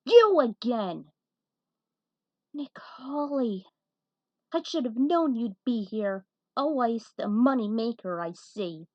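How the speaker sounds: a character confronting a hated rival; angry recognition. A narrator's reading. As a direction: spit out his name.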